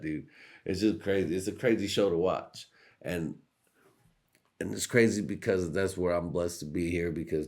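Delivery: usual speaking voice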